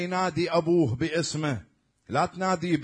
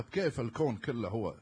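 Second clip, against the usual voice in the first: ordinary talk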